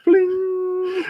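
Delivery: high, ringing clip-ejection ping; drawn-out high "bling"; imitated metallic ping. Shown as a demonstration. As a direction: ring it out high like flying metal. Imitates the clip ejecting after shots.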